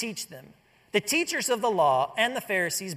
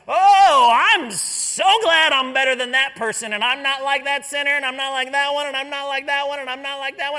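high, loud, self-satisfied boasting; pompous prayer of a proud man; smug Pharisee; 6 semitones higher, 10 dB louder than usual. Acted. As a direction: look down on everyone around you. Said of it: "he sees around and says".

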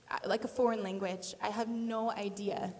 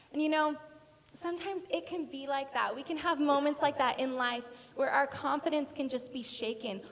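usual speaking voice

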